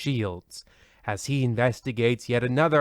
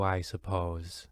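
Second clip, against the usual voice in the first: grudging mutter; 7 semitones lower, 11 dB quieter, slower than usual. Acted. Host unimpressed by the ad.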